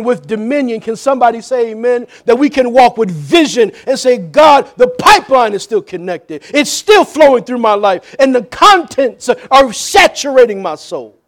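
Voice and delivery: loud, rising sermon build; preach. Real, not acted. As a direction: drive it home phrase by phrase, calling out to God. Preaches at full force.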